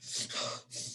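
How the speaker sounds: sharp gasp. Shown as a demonstration. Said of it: "it's the ugly cry".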